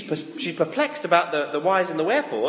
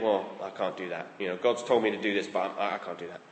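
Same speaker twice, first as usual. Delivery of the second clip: small, much softer and lower voice of self-doubt; fearful inner voice; 12 semitones lower, 9 dB quieter than usual. Retold or imitated. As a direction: shrink down into a quiet, timid refusal.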